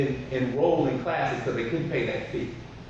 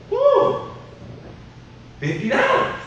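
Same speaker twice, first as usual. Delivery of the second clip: price shock; slower than usual. Retold or imitated. Dad seeing the shoe price, a shocked yelp.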